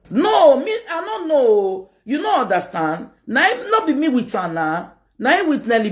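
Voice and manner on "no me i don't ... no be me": high protesting denial. Real, not acted. Denying involvement to her audience.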